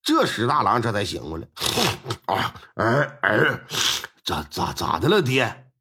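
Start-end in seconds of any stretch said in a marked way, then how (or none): 2.3-5.7 low, stammering, half-asleep voice; groggy just woken. Acted. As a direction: mumble like you're barely awake. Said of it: "睡眼惺忪".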